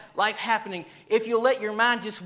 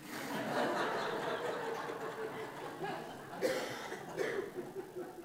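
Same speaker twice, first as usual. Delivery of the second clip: big laugh; 11 dB quieter than usual. Real, not acted. Laugh after punchline.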